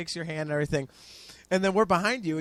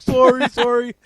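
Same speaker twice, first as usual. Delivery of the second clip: loud, higher apology through laughter; laughing apology; 7 semitones higher, 11 dB louder, slower than usual. Retold or imitated. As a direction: laugh it off while saying sorry. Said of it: "I'm like".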